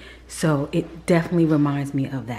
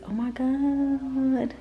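delighted gasp; 9 semitones higher, 4 dB quieter, much slower than usual. Real, not acted. Admiring baskets.

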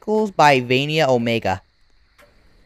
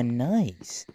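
ordinary talk

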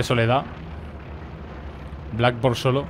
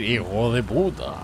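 slow, lower movie-dub delivery; dubbing voice; 3 semitones lower, 6 dB quieter, slower than usual. Retold or imitated. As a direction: say it like a Spanish dubbing actor. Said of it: "una voz súper de doblaje".

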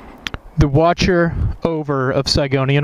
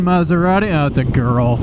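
ordinary talk

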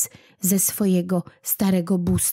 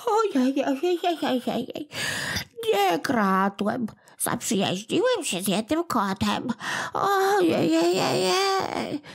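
very high, tearful whine of a tiny creature; a sore little imp wailing for pity; whimpering little goblin; 9 semitones higher than usual. Acted. Little kłobuk crying to old Smętek, very high and tearful.

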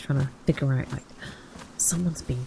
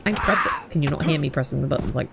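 normal talking voice

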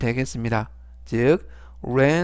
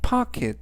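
pocket with the po pitched high and punched; stress on first syllable; 9 semitones higher than usual. Shown as a demonstration. Teacher showing students the stress, po stressed. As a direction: hit the po in pocket. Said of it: "강세는 앞부분에 있는 오의 강세가".

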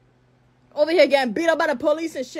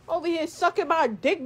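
ordinary talk, nothing marked

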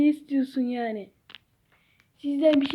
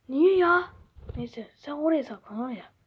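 ordinary delivery

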